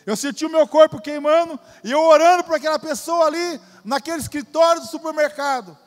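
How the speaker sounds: preacher swept up in telling the healing; fervent preaching. Acted. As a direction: let the fire of the memory lift the voice. Repeated with rising fervor.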